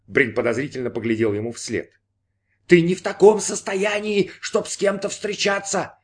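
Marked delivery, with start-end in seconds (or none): 2.7-6.0 high inner voice, slowing at the end; insistent. A narrator's reading. Inner voice tells Brin he is unfit, high and insistent. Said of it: "настаивал голос".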